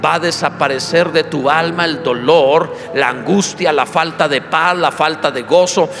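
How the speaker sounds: rising to a loud, fervent proclamation; full-force preaching. Real, not acted. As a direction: build the blessing to a ringing climax.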